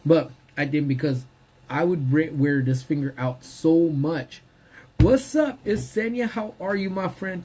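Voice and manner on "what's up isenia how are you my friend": high, warm welcome; excited greeting. Real, not acted. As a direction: sing out a hello. Greeting viewer joining live.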